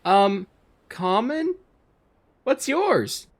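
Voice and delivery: put-on high little girl voice; small girl voice. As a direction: speak as a small innocent girl. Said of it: "you hear the voice of a small girl".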